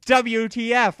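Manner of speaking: loud, high shocked call; shocked outcry. Acted. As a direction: yell it at the fighting boys. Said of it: "I asked".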